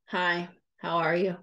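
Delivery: low, curt, irritated hello; grudging hello, flat and low; annoyed greeting. Shown as a demonstration. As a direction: greet them like they're a bother. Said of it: "sound maybe more like I'm frustrated".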